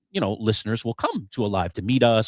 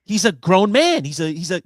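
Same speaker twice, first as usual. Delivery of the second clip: high, loud, stunned; can't believe your eyes; shocked disbelief; 9 semitones higher, 6 dB louder than usual. Retold or imitated. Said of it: "I'm like looking at Bud like".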